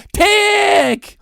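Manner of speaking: sharp high shouted tick. Acted. Ranter mimics the clock, shouting. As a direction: spit out the tick.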